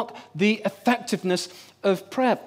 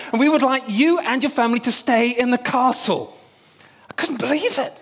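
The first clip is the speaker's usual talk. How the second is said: ordinary talk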